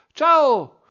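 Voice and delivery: put-on very high, loud little girl's shout; child shouting goodbye. A narrator's reading. Chiara shouting goodbye at the gate, high loud shout. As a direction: yell goodbye like a small child. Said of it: "mi gridò ancora".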